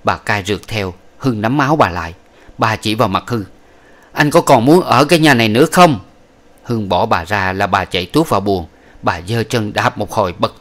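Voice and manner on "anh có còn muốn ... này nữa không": angry threat. Acted. Old woman threatening grandson-in-law.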